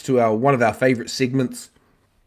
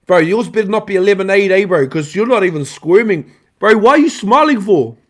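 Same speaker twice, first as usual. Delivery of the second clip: loud, high, teasing banter; excited teasing; 5 semitones higher, 5 dB louder than usual. Real, not acted. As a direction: rib him as he eats. Teasing friend eating lemon.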